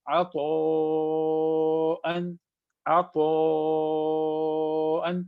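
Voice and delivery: عطاءً with the long a held four beats, sung out; drawn-out Quranic recitation; long vowel stretched. Shown as a demonstration. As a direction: stretch the madd in عطاءً slowly. Teacher demonstrates long madd.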